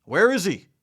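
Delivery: loud, high urgent shout. A narrator's reading. Villagers racing up to help the boy, urgent shouting. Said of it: "they shouted".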